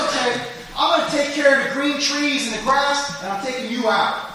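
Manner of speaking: loud, high booming judgement as God; God's verdict. Acted. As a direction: pronounce sentence as God. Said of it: "God says".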